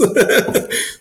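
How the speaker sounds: a short airy laugh escapes; breathy laugh. Real, not acted. Guest laughs recalling a moving testimony, airy laugh.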